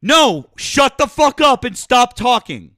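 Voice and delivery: high, loud furious yelling, slowing; angry yelling. Real, not acted. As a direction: yell each word at him. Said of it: "from all that yelling".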